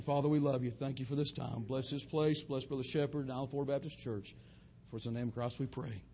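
low, very soft prayer voice; closing prayer. Acted. Pastor softly praying over the church in prayer. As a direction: murmur the benediction reverently.

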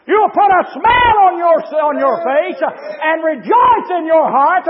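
full-force preaching. Real, not acted. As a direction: build from urgent exhortation to a ringing pulpit shout.

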